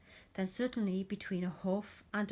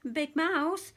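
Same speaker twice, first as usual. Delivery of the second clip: high, loud calling out; little mouse calling for help; 8 semitones higher, 8 dB louder, much slower than usual. A narrator's reading. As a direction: call up high like a small scared mouse. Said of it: "called Little Mouse".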